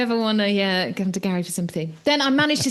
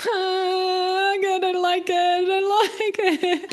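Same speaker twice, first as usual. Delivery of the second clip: much higher, slow, whimpering; hammed-up fearful whine; mock whimper; 10 semitones higher, much slower than usual. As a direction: wail it like a frightened child. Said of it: "And I just was like".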